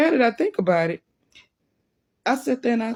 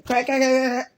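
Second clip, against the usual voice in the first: higher-pitched rapid quacks; duck quacking; 6 semitones higher than usual. Retold or imitated. Duck being carried around the park, steady quacking. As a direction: imitate a duck that won't stop quacking. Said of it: "Just moving and quacking".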